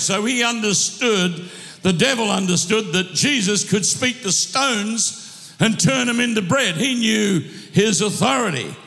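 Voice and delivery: ordinary talk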